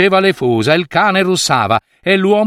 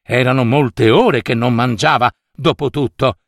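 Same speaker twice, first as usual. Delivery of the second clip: ordinary delivery, nothing marked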